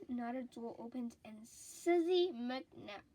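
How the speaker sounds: usual speaking voice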